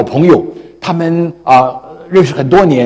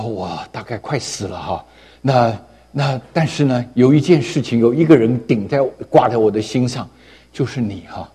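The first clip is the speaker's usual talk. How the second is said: much softer and lower, a faint voice near death; dying man; 4 semitones lower, 6 dB quieter than usual. Acted. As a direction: play a weak man on his deathbed. Dying man speaks to old friend.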